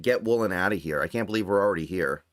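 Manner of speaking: ordinary talk, nothing marked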